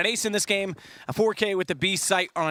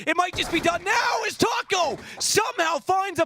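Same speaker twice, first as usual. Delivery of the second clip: high, rising hype call; excited caster; 12 semitones higher than usual. Real, not acted. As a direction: build to a shouted call. Caster hypes surprise headshot.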